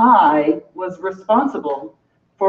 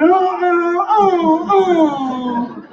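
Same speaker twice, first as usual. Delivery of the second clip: great slick moan; 6 semitones higher, much slower than usual. Acted. Performer acting out the great slick moan on stage. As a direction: run of high sliding moans. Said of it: "The great slick moan".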